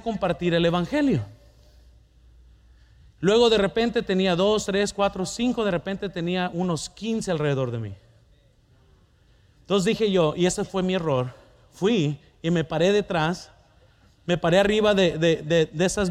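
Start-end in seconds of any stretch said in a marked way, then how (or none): none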